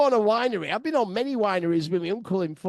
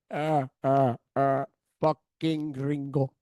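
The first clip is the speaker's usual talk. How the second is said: lower, slow, chuckling taunt; mocking gangster laugh; 6 semitones lower, slower than usual. Cartel man laughing at the tourists, lower and slow. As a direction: sneer it out between laughs. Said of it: "he starts laughing".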